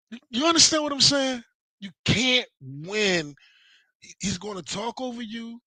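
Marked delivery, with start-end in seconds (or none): 1.8-3.3 slow, heavy and final; slow emphatic. Real, not acted. Telling chat you can't win, slow heavy emphasis. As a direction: drag it out, let it land. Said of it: "stresses the point".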